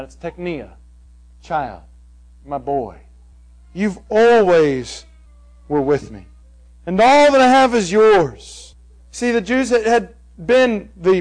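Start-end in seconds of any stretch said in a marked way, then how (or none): 1.4-8.7 tender. Retold or imitated. Father tenderly answering his resentful son. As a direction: speak it like a loving father to his boy. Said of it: "it's more tender than that".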